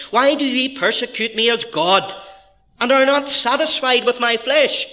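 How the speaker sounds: high, loud reproachful quotation; impassioned protest. Acted. Preacher voicing Job to his accusers, impassioned protest. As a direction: challenge them as an aggrieved sufferer.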